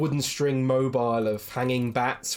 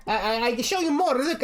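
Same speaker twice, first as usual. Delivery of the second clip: high, sing-song shopkeeper voice; eager toymaker selling to strangers; toymaker Blinsky; 13 semitones higher than usual. Acted. GM as toymaker greeting adventurers, high and sing-song.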